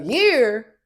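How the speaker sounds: aghast, loud disbelief; incredulous outburst. Retold or imitated. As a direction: Amari in confessional, loud and incredulous. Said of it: "in confessionals, he was like".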